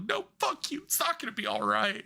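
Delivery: mock-sobbing outburst; imitating sobbing rage. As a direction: whine it out like a furious crying fan. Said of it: "I started screaming".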